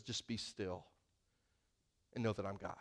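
soft and still, almost under the breath; hushed. Acted. Pastor sharing God's nudge with the congregation, hushed. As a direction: let the verse settle quietly. Quoting the verse God nudged.

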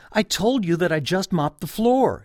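a touch of fed-up scolding; exasperated. Retold or imitated. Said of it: "she cried, exasperated".

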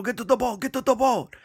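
high, urgent Cajun holler; hammed-up high-pitched yell; retold screaming. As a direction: imitate a captain yelling in alarm. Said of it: "like, screaming".

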